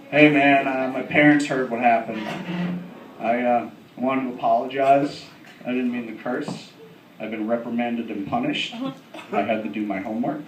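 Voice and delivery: low, soft, halting apology; sheepish and subdued, with pauses; polite apology. Retold or imitated. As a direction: imitate a chastened kid reciting his apology. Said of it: "It's very polite of a five-year-old".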